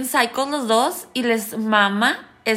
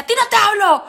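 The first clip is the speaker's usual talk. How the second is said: high, loud mock retort; retold angry snap; 8 semitones higher, 5 dB louder than usual. Boyfriend to the wrong Elizabeth, imitated angry retort. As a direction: shoot it back, louder and higher.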